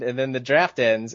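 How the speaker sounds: ordinary talk, nothing marked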